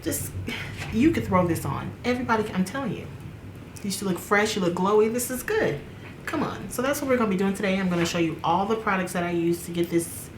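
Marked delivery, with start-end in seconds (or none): none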